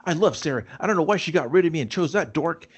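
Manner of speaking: mock bravado from a hyped-up ex; retold gassed-up Kip. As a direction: play him all fired up, pitched high. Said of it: "I'd get Kip gassed up".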